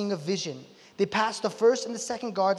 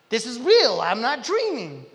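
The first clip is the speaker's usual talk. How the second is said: loud dawning amazement, stretching out the end; amazed realization; 2 semitones higher, 6 dB louder than usual. Retold or imitated. Peter realizes he's outside and free, amazed realization. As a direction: exclaim it like someone acted out waking to the truth.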